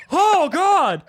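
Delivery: loud, high cry of horror; mock horror. Friends react to the disfigured man's story, mock horror. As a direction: blurt it high and loud, hammed-up. Reacting to scary testimonial, retold.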